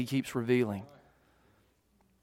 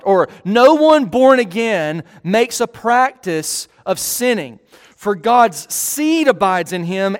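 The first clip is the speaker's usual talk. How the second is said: slow, deliberate verse reading; weighty reading of scripture; 4 semitones higher, 6 dB louder, slower than usual. Acted. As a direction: read each phrase of the verse slowly. Reads the Bible verse aloud.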